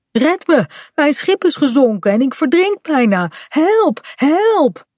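a child playing drowning, calling out; mock cry for help. Shipwrecked boy playing at drowning, high mock cry for help.

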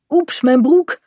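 high, mild startled exclamation; calling out. A narrator's reading. Kaat gets soaked by a wave, calling out. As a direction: exclaim over a sudden splash. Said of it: "roept Kaat".

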